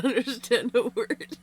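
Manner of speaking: high-pitched, amused, breaking into laughter; real laughter bubbling into the words; laughing while talking. Real, not acted. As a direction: talk through the giggles. Cracking up at own joke.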